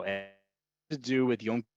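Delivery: ordinary delivery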